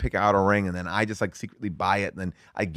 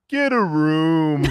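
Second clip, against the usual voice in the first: put-on slow, higher taunting kid voice; mocking kid; 5 semitones higher, 3 dB louder, much slower than usual. Imitating a kid on the bluff teasing them, slow taunt. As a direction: drag it out like a cheeky kid. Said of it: "kid go".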